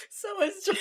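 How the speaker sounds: very high squealing laugh; laughing fit. Real, not acted.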